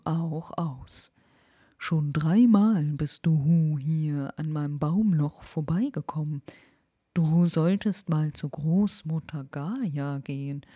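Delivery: put-on the owl teasing the lost girl. Owl character speaking.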